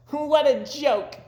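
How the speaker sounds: very high, slow mocking bird voice; jeering robins. Robins jeering at Toad's kite, very high and slow. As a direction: sneer it out high.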